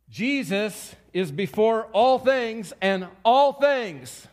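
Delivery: rising from firm to loud, slowing and lifting in pitch; building preacher's cry. Acted. Preacher to his congregation, building to a slow loud proclamation. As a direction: swell into a slow, ringing proclamation.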